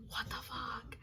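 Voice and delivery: slow, drawn-out whisper; whispered disbelief. Real, not acted. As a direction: stretch it out in an appalled whisper. Exasperated reaction to her own story.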